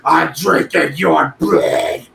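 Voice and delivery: slow, eerie ghost moan; ghostly voice. Acted. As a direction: drag it out like a haunting spirit. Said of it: "That sounded like a ghost".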